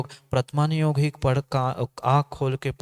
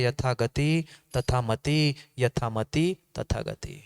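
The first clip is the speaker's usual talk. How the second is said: slow, dropping, weighty saying; slow proverb; slower than usual. Acted. Preacher states the karmic maxim slowly and gravely. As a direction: lay out the maxim word by word.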